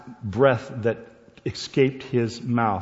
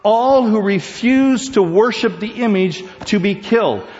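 higher, louder preacher repeat; full-force preaching; 10 semitones higher, 7 dB louder than usual. Acted. Preacher repeating the verse for emphasis, full force. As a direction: ring it out like a preacher.

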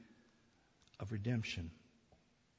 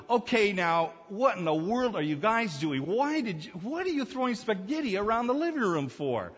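father's exasperated question; 9 semitones higher, 8 dB louder than usual. Retold or imitated. Father confronting sons over spaghetti.

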